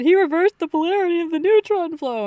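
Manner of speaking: gushing, high and loud; excited. Retold or imitated. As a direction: blurt it out thrilled. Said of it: "I said".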